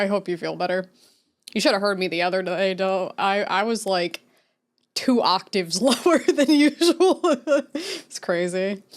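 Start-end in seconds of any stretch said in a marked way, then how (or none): 4.9-8.8 laughing through the words; words break into giggles. Real, not acted. Laughs while talking.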